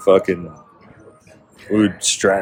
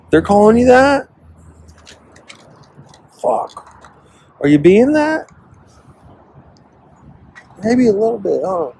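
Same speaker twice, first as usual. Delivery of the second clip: high sing-song taunt; mock taunting voice; 12 semitones higher than usual. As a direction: needle him in a high squeaky voice.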